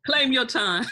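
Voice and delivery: loud, high, drawn-out cheer through laughter; playful laughing call-out. Real, not acted. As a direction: egg them on, laughing. Co-host playfully cheers on the reader.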